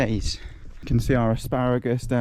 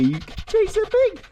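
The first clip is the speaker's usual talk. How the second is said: teasing dog talk. Real, not acted. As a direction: tease the dog in a squeaky coo. Baby-talks his dog.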